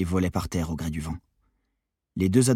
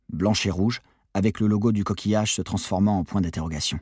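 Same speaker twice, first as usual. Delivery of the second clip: plain reading voice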